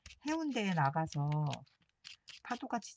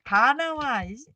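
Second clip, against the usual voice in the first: loud, high, mock-threatening shout; playful mock yell; pretend tough yell; 7 semitones higher, 11 dB louder, slower than usual. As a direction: yell like a hothead picking a fight. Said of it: "막 이러면서 소리 지를까요".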